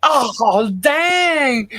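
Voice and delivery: high, drawn-out delighted "dang"; thrilled at the news; excited. Real, not acted. Reacting to tray of cobbler.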